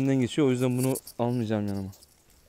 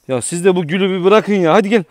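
loud call; 6 semitones higher, 10 dB louder than usual. Real, not acted. Calling the dogs to come.

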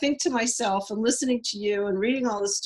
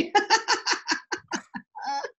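high laugh; 12 semitones higher than usual. Real, not acted. Laughs after clarifying.

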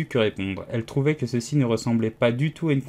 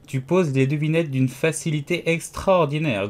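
ordinary delivery

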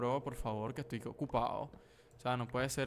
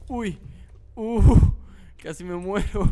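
sudden loud yelps, then a gasped line; startled yelps; 7 semitones higher, 9 dB louder, slower than usual. Real, not acted. Gamer yelping as a creeper nearly kills him, startled yelps. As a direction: jump out of your skin at each hit. Jump-scared by a creeper mid-game.

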